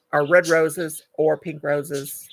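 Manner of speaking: usual speaking voice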